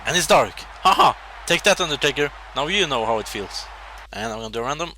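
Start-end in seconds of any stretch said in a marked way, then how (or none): 0.8-1.1 loud triumphant ha-ha; gloating laugh. Real, not acted. Gamer laughs loudly at Undertaker, triumphant. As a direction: laugh it out loud like you just won.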